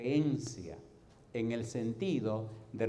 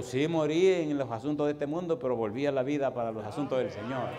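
louder, emphatic retold answer; triumphant reply; 3 semitones higher, 8 dB louder than usual. Telling the old doctor he came back for the Lord, proud loud reply. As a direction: proclaim it like a testimony.

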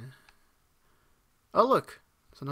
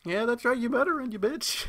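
high-pitched gloating jeer; taunting; 10 semitones higher, 3 dB louder than usual. Real, not acted. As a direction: crow at a fleeing enemy. Taunts a fleeing enemy.

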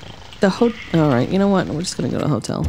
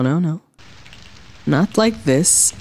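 usual speaking voice

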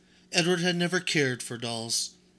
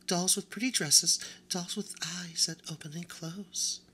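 high, hushed and haughty; a vain doll spreading cruel gossip; snooty doll whispering; 5 semitones higher than usual. Acted. The doll tells Edward nobody will buy him, high and hushed.

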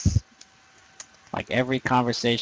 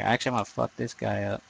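ordinary talk, nothing marked

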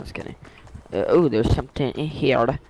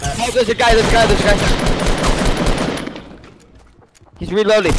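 high-pitched urgent warning; panicked game callout; 8 semitones higher, faster than usual. Real, not acted. Player warning squad of an attacker, real high-pitched alarm.

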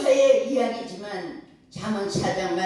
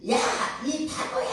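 mock child's yell; 9 semitones higher than usual. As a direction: call it out high, like a child yelling at a mountain. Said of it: "소리를 지르니까".

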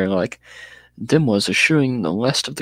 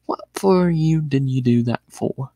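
slow, breathy half-whisper of a dim thug; slow puzzled whisper, not too bright; ignorant way; 3 semitones higher than usual. A narrator's reading. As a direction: mumble it like a thick, puzzled lout. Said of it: "he said in his ignorant way".

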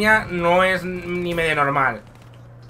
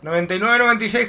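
normal talking voice

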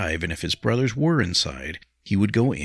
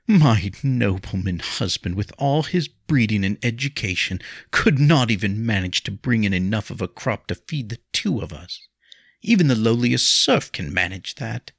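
scornful, higher-pitched complaint; bitter; 5 semitones higher, 4 dB louder than usual. A narrator's reading. Wife complaining about her nobleman husband, bitterly. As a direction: lean on the words with bitter contempt. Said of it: "Lindsay laughed bitterly".

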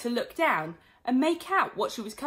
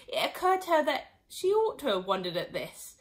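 ordinary delivery

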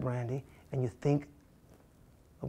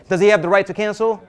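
louder repeat; 7 semitones higher, 12 dB louder than usual. Shown as a demonstration. Coach repeats question, louder.

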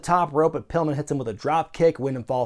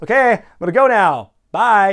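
mock-awkward louder, higher sign-off; awkward exit; 4 semitones higher, 7 dB louder than usual. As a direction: imitate someone unsure how to leave, raising the voice. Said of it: "It just looks so awkward".

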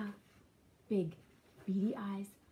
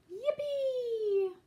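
high, gleeful whoop; squealed celebration; joyful cheer; 13 semitones higher than usual. A narrator's reading. Pigs celebrate in story.

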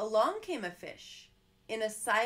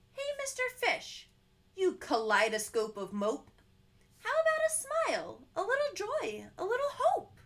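much higher, sing-song polite squid; sweet squid; 8 semitones higher, 4 dB louder than usual. Acted. Squid sweetly urging the fish to smile, high and lilting. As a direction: coax him kindly, lilting high.

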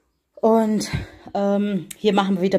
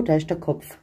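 ordinary talk

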